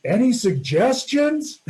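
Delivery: high, slow shout down the cliff; hollering down for help, half laughing; shouted question. Retold or imitated. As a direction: call it down to your partners below, drawn out. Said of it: "shouting down to David".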